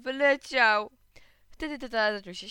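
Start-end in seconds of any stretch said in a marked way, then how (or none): 0.0-0.9 loud, high wailing shout; upset cry. A narrator's reading. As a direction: wail it out like an upset little boy. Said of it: "kliknąłem". (głośny, płaczliwy krzyk dziecka)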